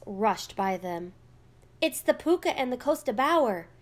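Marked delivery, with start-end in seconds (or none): 1.8-3.7 quick, raised-pitch cry of fright; a contained, narrated shriek; frightened shriek. A narrator's reading. Girl seeing the fire wagon rush by, shrieking. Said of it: "Ailish shrieked".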